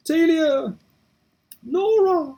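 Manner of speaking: high, desperate shout of names; anguished shouting. Acted. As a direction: scream their names into the fire. Calling out to trapped family.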